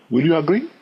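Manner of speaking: usual speaking voice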